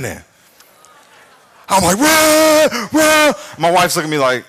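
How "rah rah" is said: high, loud motor whir; mimicked machine noise, pitched way up; imitated blender roar. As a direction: roar like a juicer in two bursts. Imitates the juicer running.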